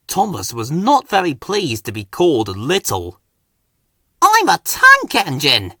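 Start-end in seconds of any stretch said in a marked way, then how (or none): 4.2-5.7 higher and louder, a proud offended huff. A narrator's reading. Small engine huffily corrects big new engine. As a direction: bristle at being called little. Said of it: "he huffed".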